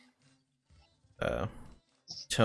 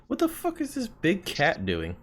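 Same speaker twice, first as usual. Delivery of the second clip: lightly voiced guard, pitched up in disbelief; puzzled sentry, higher voice; baffled guard; 10 semitones higher than usual. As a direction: play a guard thrown by what he sees. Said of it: "guards just look at you like".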